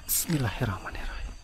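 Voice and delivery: breathy murmured prayer; whispered prayer. Real, not acted. Ghost hunt, whispering prayer.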